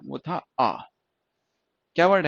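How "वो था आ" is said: drawn-out long vowel "आ". Shown as a demonstration. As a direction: hold "आ" long and open. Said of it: "पहला जो words था हमारा".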